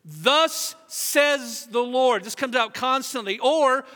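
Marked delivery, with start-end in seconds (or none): 0.0-2.2 slow booming prophet voice; grand biblical pronouncement; solemn proclamation. Retold or imitated. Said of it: "Didn't have quite the authority".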